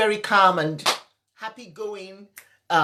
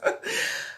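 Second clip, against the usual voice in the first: teary laugh; 13 semitones higher than usual. Real, not acted. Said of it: "I didn't know I'd be this emotional".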